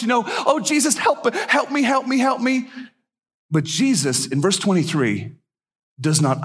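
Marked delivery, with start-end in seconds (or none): 0.3-2.7 high-pitched mock screaming plea; imitated cry for help. Woman screaming out to Jesus for help, imitated cry. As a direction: plead high and urgent, imitating a desperate woman. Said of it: "this woman is screaming out".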